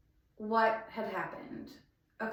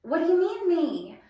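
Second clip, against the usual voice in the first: 1 shocked disbelief; 8 semitones higher, 6 dB louder than usual. Retold or imitated. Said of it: "And I was like".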